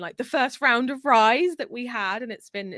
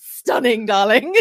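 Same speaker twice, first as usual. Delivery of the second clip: mock diva gush; 10 dB louder, slower than usual. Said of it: "is the word that I wanna use".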